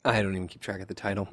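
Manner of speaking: talking through hiccups; words broken by hiccups. Real, not acted. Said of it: "I've got the hiccups".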